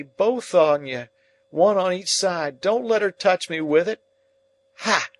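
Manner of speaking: high, loud screaming panic; terrified screaming. Acted. Dying man screaming in terror to his keepers. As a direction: scream as the ghost comes for you. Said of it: "screams out".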